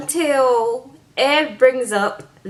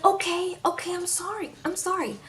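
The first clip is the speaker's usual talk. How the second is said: high, soft mock apology; hushed, placating retelling of an apology; imitated apology; 6 semitones higher, 9 dB quieter than usual. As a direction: back down meekly, voice lifted and small. Acting Ed's apology after her explanation.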